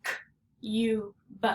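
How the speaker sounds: K, U, B as isolated sounds; sounding out. Shown as a demonstration. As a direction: break cube into "K", long "U", "B". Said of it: "its long sound U".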